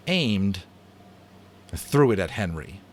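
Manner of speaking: ordinary delivery, nothing marked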